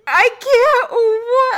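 high, loud, crying-out mock panic; a half-laughing cry of fright; retold panic. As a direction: squeal it like you can't bear to watch. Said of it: "I was like".

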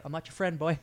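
soft, joking put-down; muted mock retort. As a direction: voice the monster's retort under the breath.

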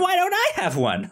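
high, mock whining Doom; mock whine. Co-host voicing Doom's jealousy in a mock whine. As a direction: pipe it up like a jealous villain.

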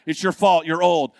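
loud, defiant retort; retold unapologetic outburst; callous blame. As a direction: throw the blame back loudly. Retold shove past fallen grandmas.

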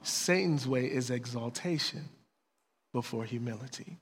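low, soft fatherly correction; quiet, patient counsel with long pauses; very gentle. Retold or imitated. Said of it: "God very gently says".

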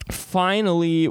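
normal talking voice

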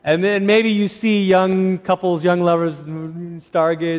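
ordinary delivery

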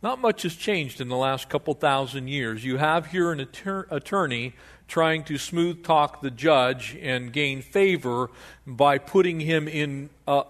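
ordinary delivery

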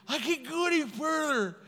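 high mock whimper; crying recruit. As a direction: answer in a pitiful put-on whine. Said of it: "'cause I'm crying".

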